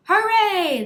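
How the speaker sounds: loud, high hooray; cheering. Acted. Children cheer the class trip, loud high hooray. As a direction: cheer like a class let off school. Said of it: "they all shouted".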